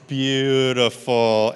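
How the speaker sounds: loud, long stretched-out "beautiful"; drawn-out praise. Real, not acted. Teacher praising the class's puppet hands, drawn-out praise. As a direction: draw the praise out slow and loud.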